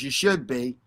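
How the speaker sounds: normal talking voice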